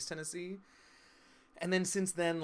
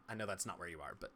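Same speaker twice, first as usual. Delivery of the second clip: ordinary talk, nothing marked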